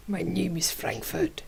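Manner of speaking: hushed joking whisper. Pretend. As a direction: whisper the silly name. Playful whisper of pun name.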